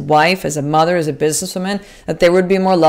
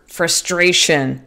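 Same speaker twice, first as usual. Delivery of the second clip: normal talking voice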